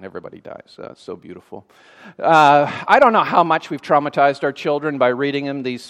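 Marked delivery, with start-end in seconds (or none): 0.0-1.6 very low, hushed aside; deadpan mock admiration. Real, not acted. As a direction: let it sink to a dry murmur. Mock admiration of grim rhyme.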